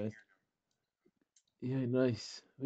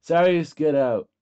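loud, drawn-out command; shouted order; 4 semitones higher, 9 dB louder, slower than usual. Real, not acted. Gamer ordering a player out, shouted order. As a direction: call out a slow, forceful order.

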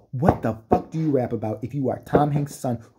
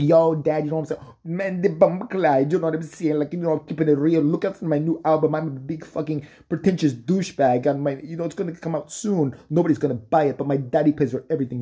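wannabe rapper son; 4 semitones higher than usual. Retold or imitated. Son talking to his dad.

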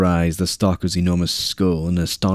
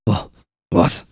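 stammering. A narrator's reading. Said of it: "the Commissar stammered".

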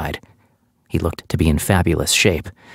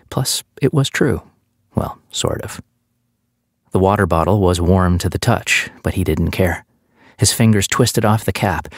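plain reading voice

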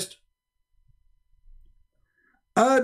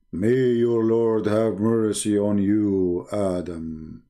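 much lower, slow solemn divine voice; solemn god voice; 9 semitones lower, slower than usual. Retold or imitated. Allah blessing Adam after his sneeze, low and solemn. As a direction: intone it like a grave deity. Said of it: "And Allah said".

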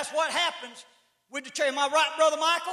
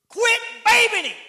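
loud, higher stern repeat; raised, emphatic command; daddy's command; 10 semitones higher, 8 dB louder than usual. Retold or imitated. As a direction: bark the order like a firm father. Repeats daddy's demand loudly.

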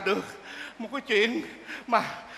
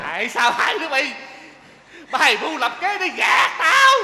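loud, high angry yell; angry shout; 3 semitones higher, 3 dB louder than usual. Acted. Father yells at daughter and groom, angry and high. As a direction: rage at the schemers.

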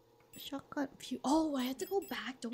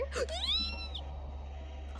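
long, thin squeal; high squeal. Real, not acted. Streamer greets a new viewer, squealing high.

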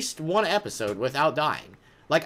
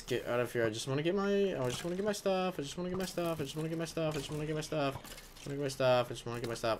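soft, repeated mutter fading to a whisper; defeated muttering; 11 dB quieter, much faster than usual. Real, not acted. Player cornered by skeletons, muttering softly in defeat. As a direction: plead under your breath over and over.

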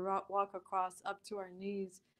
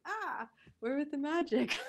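excited; 7 semitones higher, 4 dB louder than usual. Retold or imitated. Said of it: "I was like".

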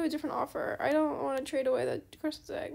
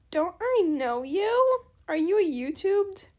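playful fan voice; 3 semitones higher, 4 dB louder, slower than usual. Pretend. Voicing the other player.

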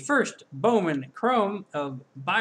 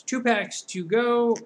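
slow, higher ring announcer call; 6 semitones higher, 3 dB louder than usual. Acted. Announcer voice again.

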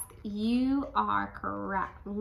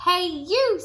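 loud, high grouchy call; grouchy shout; 10 semitones higher, 9 dB louder than usual. Acted. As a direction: yell a grumpy challenge. Ladybug challenges rhino.

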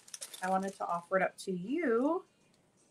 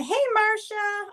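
high, slow drawn-out hello; sing-song greeting to a friend; bright greeting; 11 semitones higher, 4 dB louder, slower than usual. Real, not acted. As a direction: call out a happy hello to a viewer. Greeting a viewer by name.

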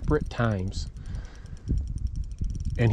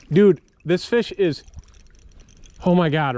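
loud, higher and breathless with awe; excited shout; 4 semitones higher, 4 dB louder than usual. Real, not acted. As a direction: shout it while the fish fights. Yelling for friend with net.

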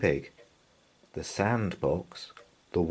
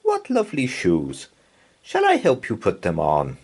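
a touch higher, friendly teacher voice; kind play leader; 4 semitones higher, 5 dB louder than usual. A narrator's reading. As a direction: coax the shy child gently. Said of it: "said Missus Sams".

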